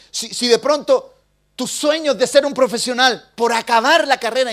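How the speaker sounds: loud, high, pleading sermon delivery; preaching at full force. Acted. Pastor urges the congregation to finish their course, loud preaching.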